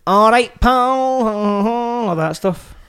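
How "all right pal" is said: higher, drawn-out "pal"; lightly put-on Glasgow ned, dragging the words; mock ned greeting. Podcaster showing co-host how neds talk, mock ned greeting.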